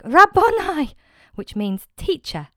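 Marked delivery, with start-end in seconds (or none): 0.0-0.9 loud, high joyful cry; Mary crying out to Jesus as she recognizes him; cried out. Acted. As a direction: call out his name in sudden recognition. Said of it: "cried out in Aramaic".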